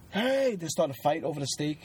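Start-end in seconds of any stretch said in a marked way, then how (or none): 0.1-0.6 cranky neighbor. Retold or imitated. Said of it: "the neighbor's like".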